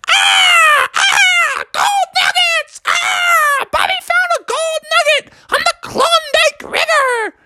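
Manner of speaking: very high shouted bird cries; loud bird squawk. Acted. The whole flock screaming about the gold nugget. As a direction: screech it like crows and eagles. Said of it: "the birds were squawking".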